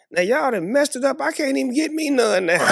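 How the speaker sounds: exasperated high-pitched griping; hammed-up complaint. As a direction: complain loudly and high in Shirley's place. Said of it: "And Shirley said".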